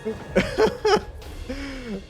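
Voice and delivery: high cackling laugh; helpless laughter. Real, not acted. Player at the failed chute, helpless laughter. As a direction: crack up at the disaster.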